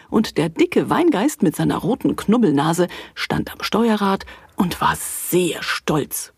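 usual speaking voice

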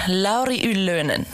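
ordinary talk, nothing marked

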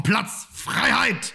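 high, drawn-out cry of freedom; mock pathos cry. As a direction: proclaim it like a hammed-up anthem. Chest hair needs freedom.

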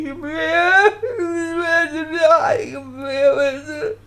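very slow, high sobbing wail. Retold or imitated. Homesick boy sobs to his friend Paul, crying. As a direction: drag the words out through sobs.

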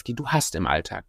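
ordinary talk

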